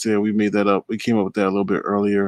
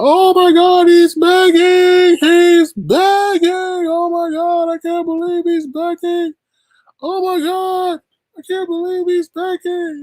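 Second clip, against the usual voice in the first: very high, squealing mock outrage, drawn out; mocking gossip voice; 8 dB louder, slower than usual. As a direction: ham up shocked critics gasping that he's begging. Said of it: "Don't take it personal and be like".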